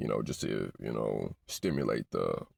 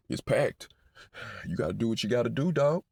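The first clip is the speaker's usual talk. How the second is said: higher, louder hyped-up clerk; front desk guy; 11 semitones higher, 4 dB louder than usual. Retold or imitated. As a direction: sell it fast like the guy on the phone. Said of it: "I called the front desk".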